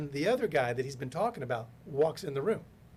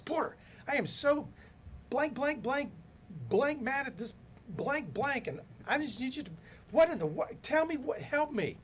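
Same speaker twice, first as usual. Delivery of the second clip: sputtering mock tirade, pitched way up; retold furious screaming; 13 semitones higher than usual. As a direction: act out a man screaming mad on the phone. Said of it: "screaming at wh-, whoever was in his car".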